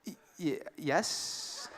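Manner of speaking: tiny, very soft stammered answer; hushed, stuttering mimicry of stunned girlfriend; imitated hesitant yes. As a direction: barely get the word out, unsure.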